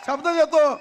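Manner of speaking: loud, high promise; rally shout. Real, not acted. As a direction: shout the pledge to the crowd.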